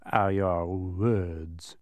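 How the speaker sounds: very slow, drawn-out disdain; disdainful. A narrator's reading. Dodecahedron handing over the last gift, disdainful. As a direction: stretch each word with disdain.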